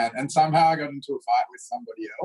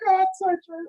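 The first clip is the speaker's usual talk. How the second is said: mock kid voice. Kids agreeing, imitated.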